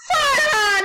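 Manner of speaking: high crying shout of the name; panicked shout. Real, not acted. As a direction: call him out in alarm, loud and high. Calling friend in game, panicked.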